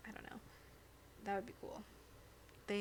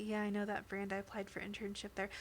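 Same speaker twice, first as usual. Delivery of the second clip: ordinary delivery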